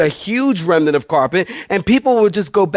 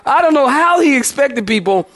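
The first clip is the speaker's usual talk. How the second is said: ordinary talk, nothing marked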